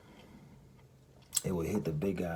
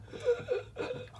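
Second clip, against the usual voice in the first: high, breathy gasp with no voice; gasping for breath. Retold or imitated. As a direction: suck in air like you can't breathe. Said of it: "And I'm like".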